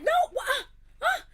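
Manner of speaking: very high, loud flustered yelps; mock panicked protest. Protesting as her friend takes the album, shrill panicked yelps. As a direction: sputter like your treasure is being taken.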